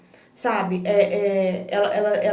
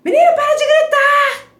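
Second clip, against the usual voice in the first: shrill, much higher yelling mother; mock yell; 11 dB louder than usual. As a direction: yell at the girl to stop yelling. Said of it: "a gente fala isso com a criança". (grito de mãe irritada, bem agudo e alto)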